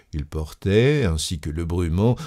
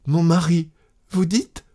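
high, bewildered question; stammering; 10 semitones higher than usual. A narrator's reading. Wife not yet understanding her cousin, stammering. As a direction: falter in a high voice. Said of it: "Elle bégayait".

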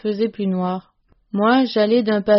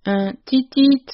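piercing high tit-tit; husband calling for his lost dog, high and shrill; 5 semitones higher, 4 dB louder than usual. A narrator's reading. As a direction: call out a long shrill tit-tit into the woods. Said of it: "prolongé, suraigu".

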